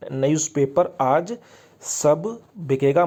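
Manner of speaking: stretched words with a gap midway; slow mnemonic. Shown as a demonstration. Teacher recalling the trick he made students memorise, slow and drawn out. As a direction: recite it slowly as a memory aid. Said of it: "याद कराया trick से".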